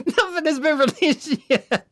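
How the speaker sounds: high, slow laughing rant; laughing disbelief. Real, not acted. Mocking early top-ten list makers, squealing with laughter. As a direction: squeal out the absurdity.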